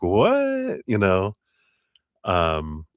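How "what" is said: high squeaky what; mock shocked what; surprised. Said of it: "but I'm like".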